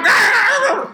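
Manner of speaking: sudden high mock yell; a high, cartoonish "ah!". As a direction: yelp it high, imitating a comedian's bit. Mimics comic speaking Mandarin.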